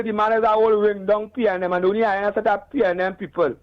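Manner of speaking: ordinary talk, nothing marked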